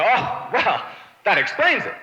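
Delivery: loud, high sarcastic revelation; mock realization. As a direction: act as if it all makes sense now. Mock acceptance of waiter's excuse.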